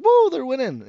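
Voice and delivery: high, loud whoop of a clueless fan; excited cheer. Retold or imitated. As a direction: cheer like a bar regular who just caught on. Old guy cheering in bar.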